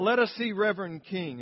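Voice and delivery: a crowd shouting for Reverend King; crowd crying out. Retold or imitated. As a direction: call it out like a crowd demanding their leader. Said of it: "they cried out".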